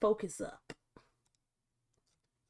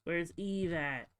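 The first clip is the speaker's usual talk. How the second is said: normal talking voice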